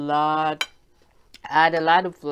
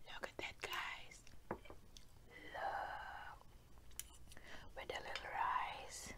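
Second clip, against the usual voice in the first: soft whisper while chewing; breathy hush over the food; 5 semitones lower, 18 dB quieter than usual. Real, not acted. Eating show, hushed to camera.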